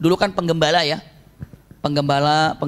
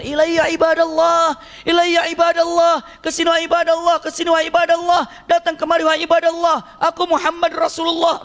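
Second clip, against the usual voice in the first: calling out loud; 10 semitones higher than usual. Retold or imitated. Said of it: "Rasulullah teriak-teriak". (seruan lantang memanggil dengan nada tinggi)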